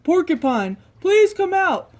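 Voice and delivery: loud, high call to the house. Acted. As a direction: call her out loudly. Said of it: "Bear called".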